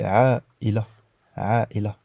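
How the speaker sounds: "Aïla" with a clean stop between the vowels; glottal break. Shown as a demonstration. Teacher demonstrating the glottal stop, clear break. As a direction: cut "Aïla" with a catch in the throat. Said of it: "il y a une petite pause".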